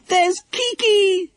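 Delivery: high, slow, drawn-out cry of joy; excited shout. Acted. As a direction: call out to the parrot in delight. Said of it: "shouted Lucy Ann".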